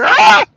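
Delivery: loud, high-pitched "ah" scream; mock panicked scream. Imitating the French as Russians come, mock high scream. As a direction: shriek in hammed-up alarm. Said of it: "they can be like".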